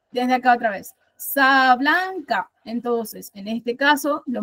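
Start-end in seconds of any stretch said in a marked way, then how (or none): 1.2-2.5 "Sa blanca" slow, in separate syllables, BLAN as strong beat; stress on BLAN. Shown as a demonstration. Teacher redoing the iambic scansion, stressing the second syllable of "Sa blanca". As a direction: sound out "Sa blanca" slowly, leaning on BLAN. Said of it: "Blanca es la segunda".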